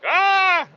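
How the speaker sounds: imitated children's shriek, very high and loud; a short panicked kid's scream; kids screaming. Kids shrieking when the dogs come near, imitated scream. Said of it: "are like".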